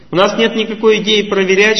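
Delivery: ordinary delivery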